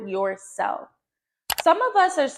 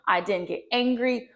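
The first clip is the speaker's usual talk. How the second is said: ordinary talk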